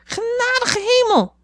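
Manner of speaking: heel hoog, traag papegaaiengeroep; dwaze papegaaienstem. A narrator's reading. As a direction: dwaas gerekt als een papegaai. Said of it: "op zo'n dwaze toon". (papegaai roept dwaas, heel hoog en traag)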